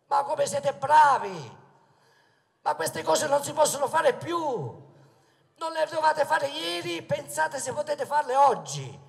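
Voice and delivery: loud sarcastic praise with a laugh; sarcastic mockery. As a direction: scoff at rivals, laughing. Mocking rivals at a rally.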